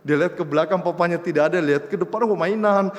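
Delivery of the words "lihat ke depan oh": normal talking voice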